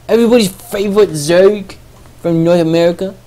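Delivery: hyped ring announcer voice; boxing announcer. Acted. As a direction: announce them like a prize fight. Announcer intro of players.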